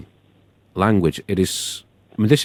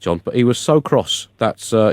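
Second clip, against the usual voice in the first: ordinary talk, nothing marked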